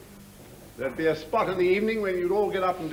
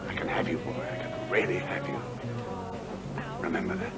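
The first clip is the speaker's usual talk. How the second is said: quiet threat; 2 semitones higher, 5 dB quieter than usual. Acted. Officer privately warns a young conscript, quiet threat. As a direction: threaten him under your breath.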